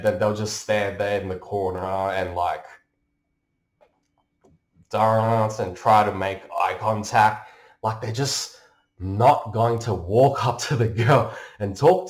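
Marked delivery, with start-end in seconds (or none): none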